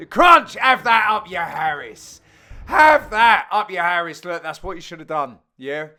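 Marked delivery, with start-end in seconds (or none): 0.1-0.5 a big shouted crunch on the smash; loud, high, excited commentary shout. Real, not acted. 0.5-2.2 gloating. Real, not acted. Gloating after the smash. 2.7-4.2 louder, triumphant gloat; crowing at the other side; loud gloating repeat. Real, not acted.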